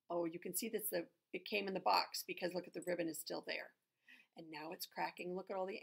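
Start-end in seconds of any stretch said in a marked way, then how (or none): none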